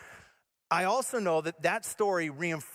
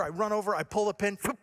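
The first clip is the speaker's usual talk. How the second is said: ordinary talk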